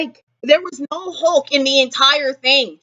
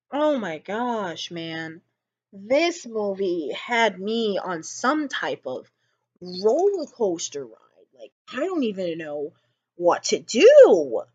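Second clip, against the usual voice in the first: hushed and stunned; 5 semitones lower, 7 dB quieter than usual. Real, not acted. Stunned after the film.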